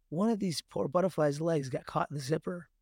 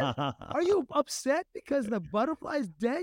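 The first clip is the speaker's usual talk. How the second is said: incredulous parent; 8 semitones higher than usual. Retold or imitated. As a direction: question the kid in mock disbelief, voice pitched up. Parent's baffled questioning of crying child.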